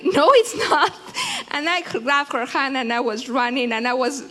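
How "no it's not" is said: emphatic denial. Retold or imitated. Retold comforting of a crying girl.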